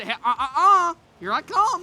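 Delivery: mock movie quote about a player; DiCaprio stammer. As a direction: stutter then announce yourself. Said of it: "that scene with Leonardo DiCaprio".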